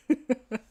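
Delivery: short high-pitched laugh; helpless laughter. Real, not acted. Streamer in stitches over his own reading, laughing. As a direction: laugh till you could cry. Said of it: "I'm crying".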